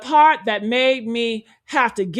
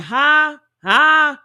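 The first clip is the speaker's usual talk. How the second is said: mock can't-hear "huh?", a bit louder; mimicking stalling; 3 semitones higher, 6 dB louder than usual. Drunk caller stalling on the phone, mock questioning. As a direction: imitate someone pretending not to hear. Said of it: "muting the phone and saying".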